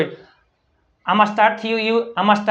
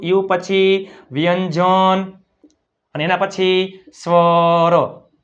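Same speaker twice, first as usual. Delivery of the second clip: slow, emphatic teaching; slower than usual. Shown as a demonstration. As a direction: rule drawn out word by word. Teacher spells out the rule slowly. (ધીમે, લંબાવીને નિયમ સમજાવતાં)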